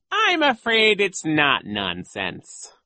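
high, slow, loud villain voice; oily mock bafflement; sly villain coach. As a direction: feign confusion, drawn out and high.

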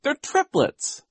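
put-on high, emphatic girl's correction; earnest girl's correction; indignant cry. A narrator's reading. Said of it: "Violet cried".

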